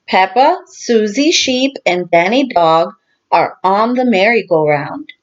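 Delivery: usual speaking voice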